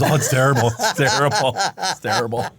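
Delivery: loud mock lament; hammed-up misery of a suffering dad; miserable dad. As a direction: imitate a dad bemoaning his lot. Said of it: "He goes".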